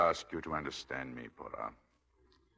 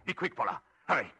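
frantic urging; faster than usual. Acted. Desperate urging, pitch jump.

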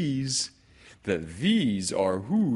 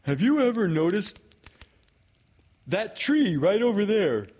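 high, loud distracting call; urgent bear, pitched way up; Pooh; 14 semitones higher, 8 dB louder than usual. Bear pointing Kanga to a tree, high and loud.

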